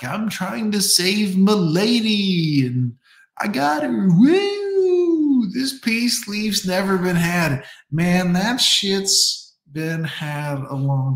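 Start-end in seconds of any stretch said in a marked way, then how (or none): 0.0-7.6 higher, pumped-up know-it-all; mock hero boyfriend. Naive groom bragging about saving his lady, hammed up. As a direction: crow like he just rescued her, whooping. Naive husband voiced as savior.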